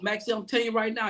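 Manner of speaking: quick, high-pitched telling-off; retold stern warning. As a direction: imitate a boss laying down the law, fast and high. Retells boss laying down the law.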